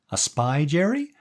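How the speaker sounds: high, rising sly question; high-pitched gangster's moll. A narrator's reading. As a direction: tease him in a high moll voice. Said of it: "the woman suggested with a high-pitched laugh".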